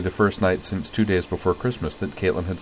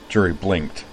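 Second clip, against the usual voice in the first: ordinary delivery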